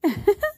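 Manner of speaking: short high mocking giggle; acted gloating chuckle; spiteful laugh. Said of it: "ردت أمل بكل كيد".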